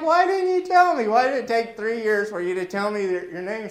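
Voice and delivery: high-pitched, loud mock outburst; exasperated. Pastor confronts Barb after three years, exasperated and high. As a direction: squeal the complaint at full volume.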